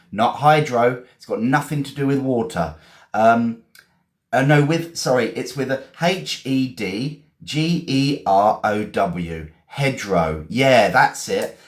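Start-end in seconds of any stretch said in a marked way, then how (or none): 6.0-9.5 letter-by-letter spelling; spelled out. Shown as a demonstration. Teacher correcting the word for learners, spelling it letter by letter. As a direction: spell it out one letter at a time. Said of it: "I'm gonna spell it".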